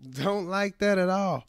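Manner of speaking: ordinary talk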